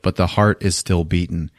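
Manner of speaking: normal talking voice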